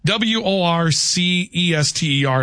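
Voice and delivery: slow letter-by-letter spelling. Shown as a demonstration. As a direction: spell it out slowly, each letter distinct. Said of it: "I believe is pronounced Worcester".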